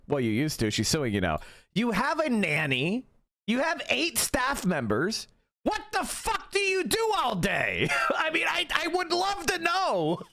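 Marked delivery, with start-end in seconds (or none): 5.6-10.2 mock shout. Ranting at Ethan.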